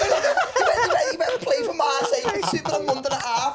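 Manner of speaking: playful laughing caricature; comedy voice. Pretend. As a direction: ham it up through laughter. Mock line in a comedy accent.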